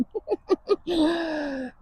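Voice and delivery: a high-pitched real laugh at yourself. Real, not acted. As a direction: let a high laugh burst out. Laughs after the rant.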